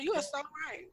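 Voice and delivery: very soft, placating concession; given-up agreement. Acted. Partner done with the conversation, placating softly. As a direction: agree quietly just to be done. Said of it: "now I done gave up on the conversation".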